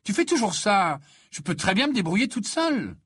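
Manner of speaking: high, irritated complaint; annoyed. A narrator's reading. Ginny tells Dean she can manage, annoyed. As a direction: complain with mounting exasperation. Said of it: "lança-t-elle agacée".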